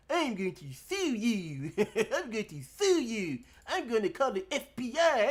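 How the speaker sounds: mocking his threat. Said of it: "he was like".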